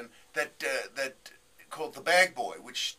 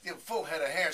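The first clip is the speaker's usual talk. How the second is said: ordinary delivery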